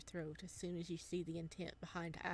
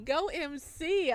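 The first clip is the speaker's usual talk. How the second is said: cheering; 9 semitones higher, 11 dB louder, slower than usual. Real, not acted. Cheering for the character.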